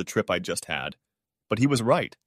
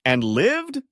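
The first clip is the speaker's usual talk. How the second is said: high, louder rising disbelief; incredulous question; 9 semitones higher, 4 dB louder than usual. A narrator's reading. Dad to the boyfriend who refused his daughter, incredulous. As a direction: end on a steep amazed upswing.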